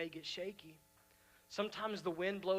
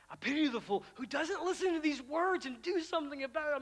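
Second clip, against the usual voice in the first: loud, high tough-guy boast; mock Mister T swagger; Mister T impression; 12 semitones higher, 9 dB louder than usual. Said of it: "I'm just picturing him a chain saying".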